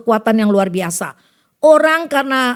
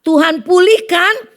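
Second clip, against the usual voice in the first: louder, higher, slow plea to God; fervent prayer; 7 semitones higher, 6 dB louder, slower than usual. Retold or imitated. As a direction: cry out the prayer slowly. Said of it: "aku berdoa". (doa yang lantang dan lambat)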